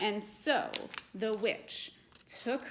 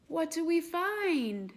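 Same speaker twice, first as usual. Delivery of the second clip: amazed gasp; 6 semitones higher, 4 dB louder than usual. Real, not acted. Opening paper to young audience.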